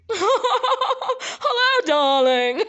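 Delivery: high, hammed-up society laugh and greeting; mock-grand high-pitched voice with showy laughs; fancy girl. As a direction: play the fancy lady, trilling with laughter. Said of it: "This is my fancy girl".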